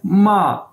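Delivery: माँ drawn out very slowly; pronunciation demo. Shown as a demonstration. Teacher to Urdu-speaking students, drawing माँ out slowly. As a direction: say माँ long and slow, rising like a question. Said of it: "इसकी pronunciation ये है".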